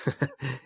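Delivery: a brief amused chuckle; short laugh. A narrator's reading. Victor laughing at Martine's joke about sex, brief chuckle. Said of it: "he said and laughed".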